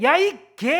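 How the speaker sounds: acted road-rage shout, high and loud; angry yell. Driver cursing another car, hammed-up angry yell. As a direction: burst out yelling at a driver. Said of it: "소리를 확 치고 싶은".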